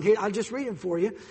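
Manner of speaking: usual speaking voice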